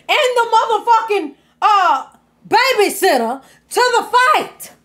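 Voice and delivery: high, loud, disbelieving outcry; exasperated yelling. Acted. Commentator yelling at Royalty over bringing kids to the fight, exasperated. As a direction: shout at her like you can't believe it.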